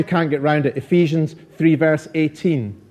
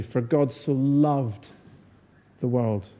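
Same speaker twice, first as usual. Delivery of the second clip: LOVED drawn out, then a pause; stress on LOVED; 3 semitones lower, 7 dB quieter, slower than usual. Shown as a demonstration. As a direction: lean slowly on "loved" and pause before "the world". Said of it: "putting the stress on different words".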